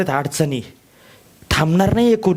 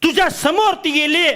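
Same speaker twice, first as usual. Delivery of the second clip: ordinary talk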